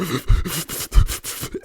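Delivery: quick, high airy gasps; mock hyperventilation. Storyteller showing viewers his speechless panic, rapid gasping. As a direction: imitate breathless panicked panting. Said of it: "I'm just like".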